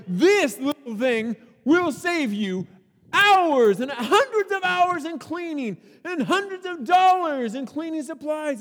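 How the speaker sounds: high, loud infomercial pitch; hyped salesman voice. Retold or imitated. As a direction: sell it like a TV ad. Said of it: "he's like".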